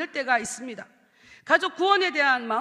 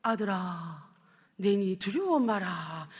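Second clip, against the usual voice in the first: lower, slow and solemn; God answering from heaven, low and slow; 6 semitones lower, much slower than usual. Retold or imitated. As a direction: speak as God from above, calm and grave. God's voice from heaven.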